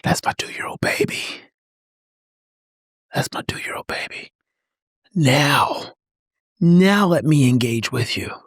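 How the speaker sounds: low, whispery, heart-melting softness; slow hushed murmur full of love; tender. Acted. Dad seeing his two-year-old as heart opens, tender whisper.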